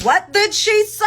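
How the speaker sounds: high mocking confused question; dum-dums in the back. Mocking dum-dums in the back who misheard her. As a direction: mimic the clueless fans in the back. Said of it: "the dum-dums in the back who was like".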